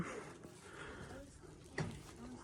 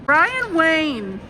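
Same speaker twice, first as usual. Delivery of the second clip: calling out; 5 semitones higher, 5 dB louder than usual. Real, not acted. Calling husband by full name.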